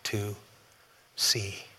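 soft, slow, pleading request; a blind man asking quietly for his sight; hushed slow plea that fades away; humble plea. Retold or imitated. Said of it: "He says".

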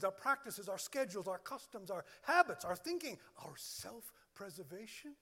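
ordinary talk, nothing marked

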